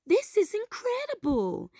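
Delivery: excited cry. A narrator's reading. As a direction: squeal it with clapping joy. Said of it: "Kida cried".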